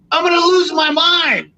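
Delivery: exasperated rant. Retold or imitated. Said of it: "I was like".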